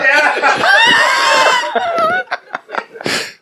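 long high burst of laughter. Real, not acted. Preacher laughing at his own sarcastic joke.

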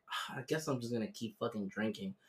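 low, soft resigned grumble; mock resigned mutter. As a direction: sigh it under your breath. Retold resigned reaction.

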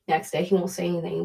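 ordinary talk, nothing marked

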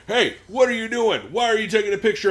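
high-pitched mock yell of an angry man; imitated yelling. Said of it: "he starts yelling".

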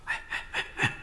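hushed, whispered hey-hey; furtive calling out. Acted. Woman with child accosting a stranger, furtive whispered call. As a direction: beckon a passer-by under your breath.

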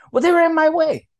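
high, loud bratty protest; whiny daughter. Retold or imitated. Father mimicking daughter blaming the bricks, loud high whine. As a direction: whine it like a stubborn kid.

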